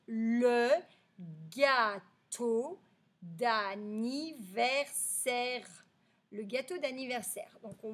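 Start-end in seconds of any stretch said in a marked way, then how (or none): 0.1-5.7 very slow, split into separate syllables; slow syllable reading. Shown as a demonstration. Teacher reading the story's title to pupils, slow syllables. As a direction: sound out the title piece by piece. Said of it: "le titre de cette histoire, ça va être".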